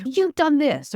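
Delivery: imitating a difficult person snapping back; mock angry accusation. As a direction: fling the blame like an angry person in a state. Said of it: "And they're like".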